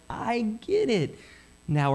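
mock moment of finally getting it; dawning understanding. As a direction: draw it out as if it just clicked. Acting out finally understanding a passage.

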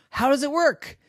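put-on wide-eyed little boy asking; high child voice. Smelly asks about candle.

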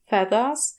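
ordinary delivery, nothing marked

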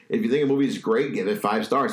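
ordinary talk

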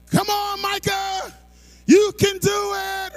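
high, slow cheer for a little brother. Retold or imitated. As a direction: urge him on from the street.

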